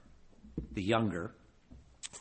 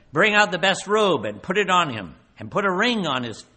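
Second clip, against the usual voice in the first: joyful father's command; 5 semitones higher, 6 dB louder than usual. Acted. Father ordering robe and ring, raised.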